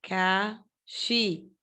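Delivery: "abacaxi" split slowly into syllables with pauses; sílaba por sílaba. Shown as a demonstration. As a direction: say "abacaxi" one drawn-out syllable at a time. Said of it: "Separando em sílaba". ("abacaxi" pausado, separando cada sílaba)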